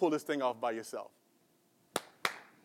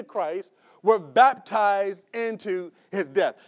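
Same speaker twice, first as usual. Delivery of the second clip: slow emphatic reading; 3 semitones higher, slower than usual. Shown as a demonstration. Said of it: "He says".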